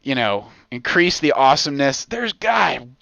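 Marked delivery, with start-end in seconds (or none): none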